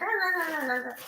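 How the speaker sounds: high mock cat yowls, repeated; imitated screaming cat. Seller mimics the cat screaming back at the old lady, high mock yowls. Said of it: "sounds like it's screaming at her".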